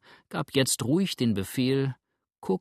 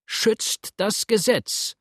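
high, drawn-out loud cry; crowd shout; 9 semitones higher, 4 dB louder, much slower than usual. A narrator's reading. Crowd answering from all sides, high drawn-out shout. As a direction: shout it slowly over a crowd. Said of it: "tönte es ihm aber überall entgegen".

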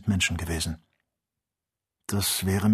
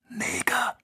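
sharp high whisper. Acted. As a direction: hiss the warning into his ear. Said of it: "Er flüsterte". (scharf geflüstert)

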